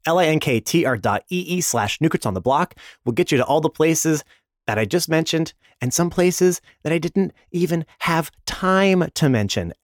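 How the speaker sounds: ordinary talk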